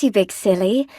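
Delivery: normal talking voice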